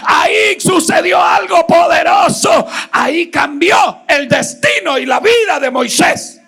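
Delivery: loud, high preaching cry; preaching at full force. Acted. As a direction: proclaim it to the whole church. (predicando a todo pulmón)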